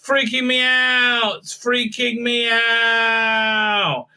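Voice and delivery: high, stretched-out panicky wail; hammed-up freak-out, drawing out the last word; mock scared. The scared aliens don't know what's going on, in a mock wail. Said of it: "They're just scared".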